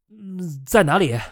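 calling out loudly. A narrator's reading. Sloane asking the others where, mildly loud. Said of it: "苏罗恩大声道".

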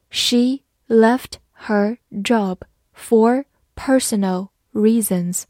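very slow, every word clearly separated; slow reading. Shown as a demonstration. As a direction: model it slowly for students to repeat. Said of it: "好，慢慢来读". (慢速跟读示范)

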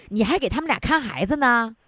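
usual speaking voice

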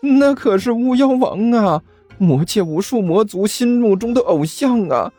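high, stammering thrilled minion; trembling excitement. Acted. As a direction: babble with thrilled awe. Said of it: "嘴唇颤抖，激动得几乎不能自己".

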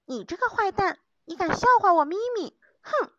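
put-on high, loud, fast childish scolding; angry shouting. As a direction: yell it like an offended kitten. Mimi scolds the squirrel.

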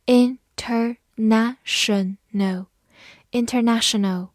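"International" said slowly, syllable by syllable; careful slow pronunciation; slow model word. Shown as a demonstration. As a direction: draw out "international" for learners. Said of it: "after syllable breakdown".

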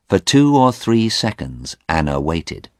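ordinary delivery

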